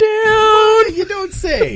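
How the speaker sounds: loud, very high squeal of fake shock; mock astonishment. Host answering co-host's obvious score-a-touchdown advice, mock astonishment. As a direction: feign huge surprise at the obvious.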